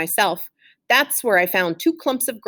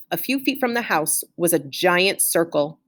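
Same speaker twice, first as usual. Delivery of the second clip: usual speaking voice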